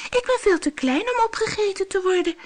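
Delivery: tiny goat begging, high pitched; squeaking goat. Acted. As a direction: squeak it like a frightened kid goat. Little goat pleads with monster.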